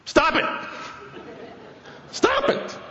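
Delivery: sudden high, sharp yelled command; Stop it shout. Retold or imitated. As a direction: snap it out like a fed-up therapist. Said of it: "Bob Newhart ... and says".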